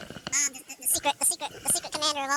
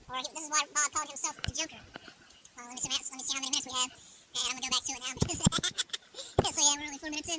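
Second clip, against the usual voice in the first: ordinary talk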